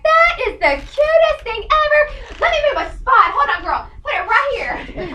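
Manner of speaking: high, loud, drawn-out gush; over-the-top delighted squeal; gushing shop girls. Retold or imitated. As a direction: imitate thrilled shop staff at full tilt. Voices shop staff loving the box.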